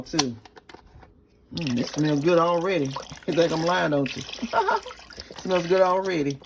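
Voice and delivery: ordinary delivery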